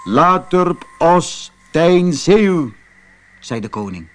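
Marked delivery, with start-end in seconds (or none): 0.0-2.7 slow, loud, odd king voice; very slow, loud king's nonsense words; backwards-talking king. Acted. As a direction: say it like strange gibberish from a moon king. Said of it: "de woorden achterstevoren zei".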